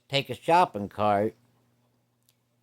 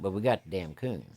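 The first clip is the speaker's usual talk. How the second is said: usual speaking voice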